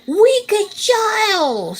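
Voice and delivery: high, furious witch scream. A narrator's reading. Witch screams at Rapunzel, a high furious shriek. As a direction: shriek it out in rage. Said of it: "screamed the witch".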